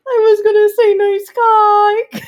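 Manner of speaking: very high, loud squeaky confession; embarrassed squeak. Retold or imitated. Confessing to a nervous partner what they held back, embarrassed squeal. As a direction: squeal it out, close to cracking up.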